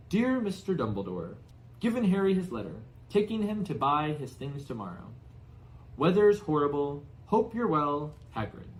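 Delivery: big, rough-edged giant reading his note aloud; a hearty giant dictating a letter; Hagrid's voice. Acted. Giant reads his note to Dumbledore aloud, bluff and loud.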